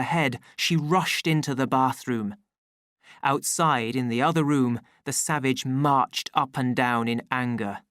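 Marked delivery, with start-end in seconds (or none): none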